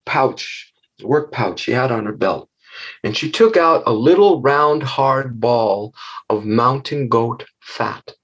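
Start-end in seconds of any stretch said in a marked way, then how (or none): none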